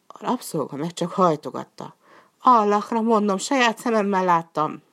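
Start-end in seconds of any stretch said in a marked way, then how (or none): 2.4-4.8 pleading oath. Acted. Slave swears to his master.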